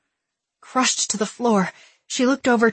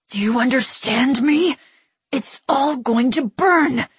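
fearful; 5 semitones higher, 3 dB louder than usual. A narrator's reading. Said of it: "his tone ragged with fear".

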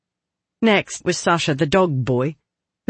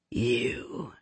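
lower, soft, growled word; 4 semitones lower, 10 dB quieter than usual. Acted. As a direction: growl it low like a cat. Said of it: "in a low voice that sounded like a growl".

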